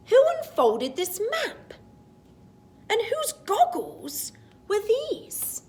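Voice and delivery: high puzzled wondering; puzzled questions. Acted. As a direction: ask it full of wonder.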